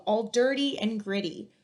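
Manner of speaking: plain reading voice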